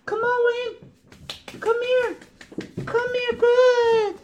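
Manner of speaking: high-pitched call to a puppy; dog voice. Real, not acted. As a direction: coax the puppy very high and loud. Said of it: "We all have our dog voices".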